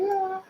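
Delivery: high squeal of joy; a hammed-up shriek of delight; imitated excited squeal; mock scream. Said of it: "Then he'll scream like".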